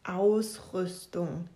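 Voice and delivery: Ausrüstung drawn out very slowly; laborious deciphering. Acted. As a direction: struggle through each syllable. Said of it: "entzifferte Frieda mühevoll".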